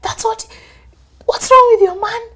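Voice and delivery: a friend aghast at your man; outraged friend. Retold or imitated. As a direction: friend shocked at the husband, high and loud.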